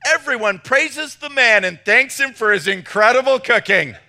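high, loud punchline delivery; reading aloud. Acted. Pastor reading the barbecue joke's payoff, loud mock-grand reading aloud. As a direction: proclaim it as the comic payoff.

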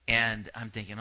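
ordinary talk, nothing marked

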